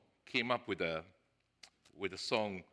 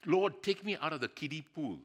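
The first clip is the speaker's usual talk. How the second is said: higher, louder appeal to the Lord; acted plea to God; 6 semitones higher, 6 dB louder than usual. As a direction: call out in earnest prayer.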